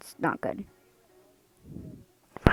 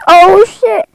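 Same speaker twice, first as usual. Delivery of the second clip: much higher, loud panicked cry; panicked disgust; 10 semitones higher, 9 dB louder than usual. Real, not acted. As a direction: squeal in fear of the snakes. Snakes appear in the game.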